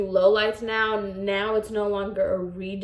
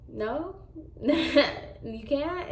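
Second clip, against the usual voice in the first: soft, higher mock-innocent questions; passive aggressive; 5 semitones higher, 5 dB quieter, slower than usual. Client pressing the stylist for a free extra, passive aggressive. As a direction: imitate a client asking sweetly, with a small laugh. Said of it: "very much that passive aggressive".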